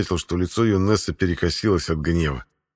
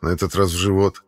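ordinary delivery

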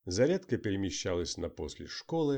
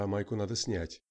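plain reading voice

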